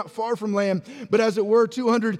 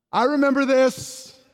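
excited recognition; 3 semitones higher than usual. Acted. John in the boat recalls the earlier catch, excited. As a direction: raise it as the memory clicks.

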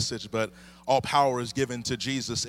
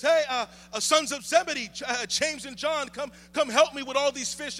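much higher, urgent calling out; excited Peter. Acted. Peter calling James and John to help, excited and high. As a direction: shout to the other boats for help. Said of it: "in his excitement and perhaps his nervousness".

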